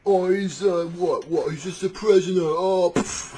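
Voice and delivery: loud, high Cockney bluster; Cockney jailer. Acted. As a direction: brush it off as a rowdy Cockney. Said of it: "I don't know why they're Cockney".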